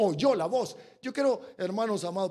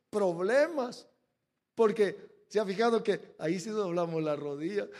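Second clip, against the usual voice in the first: usual speaking voice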